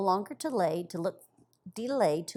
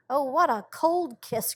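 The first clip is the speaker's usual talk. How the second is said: surprised cry; 5 semitones higher, 3 dB louder than usual. A narrator's reading. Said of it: "cried Peony".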